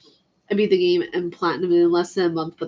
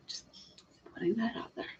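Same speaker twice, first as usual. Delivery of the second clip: whispered aside, barely voiced; half-voiced cheeky whisper; 3 semitones higher, 16 dB quieter than usual. Real, not acted. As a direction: breathe it out as a secret joke.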